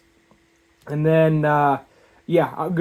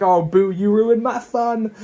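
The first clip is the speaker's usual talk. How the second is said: mock pouting; 5 semitones higher, slower than usual. Playful dad pouting at daughter.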